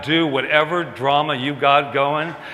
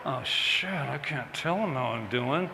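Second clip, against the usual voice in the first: muttered inner thought; 10 dB quieter than usual. Retold or imitated. Inner panic, thought aloud.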